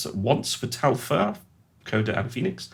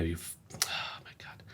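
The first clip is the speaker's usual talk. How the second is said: whisper; 4 semitones lower, 16 dB quieter than usual. Real, not acted. Startled mid-video, whispering.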